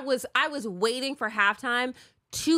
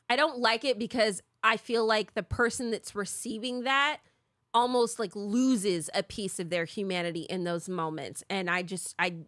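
normal talking voice

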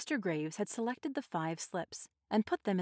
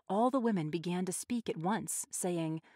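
ordinary delivery, nothing marked

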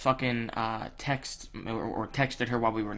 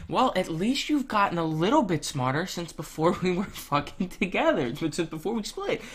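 higher-pitched mock praise with laughs breaking in; sarcastic jab; 7 semitones higher than usual. Real, not acted. Mocking his ex-girlfriend's prediction in a higher, sarcastic voice. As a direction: sneer it out as a backhanded compliment. Mocking ex after her text.

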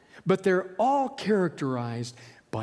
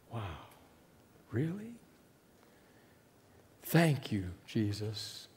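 much softer, lower and humbled; hushed awe; 7 semitones lower, 9 dB quieter, slower than usual. Pretend. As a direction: breathe out your thanks in awe. Humbled thanks to Jesus.

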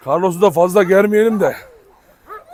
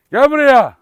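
sharp raised come-here; scolding call; 7 semitones higher, 8 dB louder, slower than usual. Real, not acted. Owner scolding Rocky to come here, angry call.

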